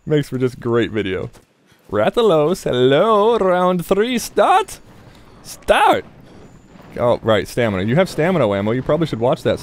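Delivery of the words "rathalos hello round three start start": a hint of a ring announcer, pitched high; mock announcer. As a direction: call the round like a playful announcer. Announcing the next round playfully.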